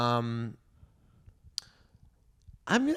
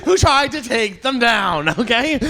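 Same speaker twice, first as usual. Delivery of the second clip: shouting, much higher and louder, slow and emphatic; heated protest; 11 semitones higher, 8 dB louder, slower than usual. Real, not acted. Denying he cried about nine eleven to the hosts, loud indignant shout. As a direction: insist on it at full volume, half laughing at himself.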